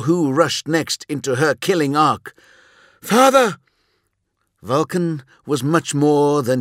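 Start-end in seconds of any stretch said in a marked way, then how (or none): 3.0-3.6 high, loud cry of alarm; panicked shout. A narrator's reading. Someone rushes into the witch's killing arc, panicked shout.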